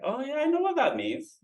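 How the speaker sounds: high, bright "aha" voice of a student; sudden pitch jump into a voice of recognition; mock realization. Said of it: "you'll be like".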